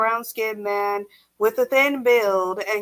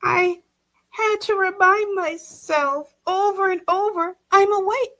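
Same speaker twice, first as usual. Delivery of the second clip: frightened; 8 semitones higher than usual. Acted. Frightened wife confides.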